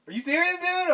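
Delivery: lightly acted "you're kidding me"; mock disbelief. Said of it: "I'm like".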